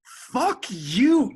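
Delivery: bitter furious outburst; angry. Acted. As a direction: spit it out in anger. Breakup fight, Nick lashes out.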